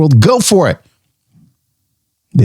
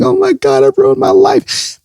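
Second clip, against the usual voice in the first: mock despair. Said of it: "I just remember crying myself to sleep".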